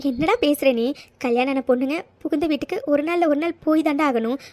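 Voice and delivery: normal talking voice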